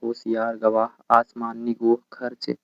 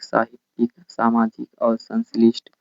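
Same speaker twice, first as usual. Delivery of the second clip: normal talking voice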